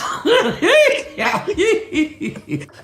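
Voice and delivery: long, high chuckle; laughing. Real, not acted. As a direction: keep chuckling at your own joke. Laughs after own punchline.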